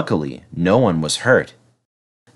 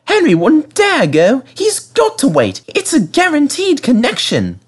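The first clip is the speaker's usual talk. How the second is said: demanding refreshment lady. A narrator's reading. Refreshment lady scolding Peter Sam for leaving her, demanding. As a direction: play the indignant tea lady.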